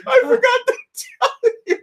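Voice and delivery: very high, whimpering mock voice; hammed-up sheepish whine; imitated dad's excuse. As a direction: squeak out the excuse near laughter. Dad's excuse repeated, retold.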